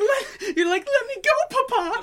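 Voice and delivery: put-on very high, loud squeal of a British kid; child begging Papa to let go, squealing high; mock British child. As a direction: shriek to be let go, laughing. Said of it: "The British are".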